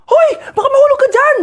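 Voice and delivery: panicked teacher calling up the wall; alarmed shout. Acted. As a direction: shout a high warning. Teacher sees kids climbing.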